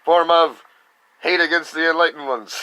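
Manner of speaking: high, loud hammed-up announcement; mock villain proclamation. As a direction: proclaim it like a comic-book supervillain. Continuing the mock villain rally.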